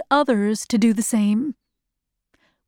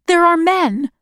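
higher, slightly raised voice; excited report; 7 semitones higher, 4 dB louder than usual. A narrator's reading. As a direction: announce a sudden sighting. Boy reports men at window.

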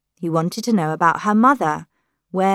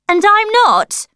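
high, loud outburst; angry shout; 9 dB louder than usual. A narrator's reading. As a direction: shout back in hurt fury. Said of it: "shouted Lily".

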